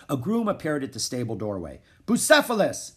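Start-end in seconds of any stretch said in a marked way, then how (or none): 2.1-2.9 high, loud summons; shouted. A narrator's reading. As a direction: call the horse's name across a barn. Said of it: "he shouted".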